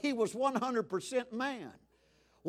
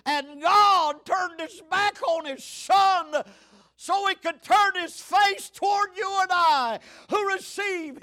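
preaching at full force; 9 semitones higher, 10 dB louder than usual. Acted. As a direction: proclaim it to the congregation. Preaching climax.

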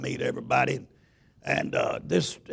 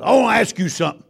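quick, higher, louder as the big man; big preacher's voice; 4 semitones higher, 5 dB louder, faster than usual. Retold or imitated. Big preacher asking the pastor a question, higher and quicker. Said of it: "that's the way he said it".

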